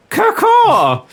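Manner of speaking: high shrill bird caw; parrot squawk. Acted.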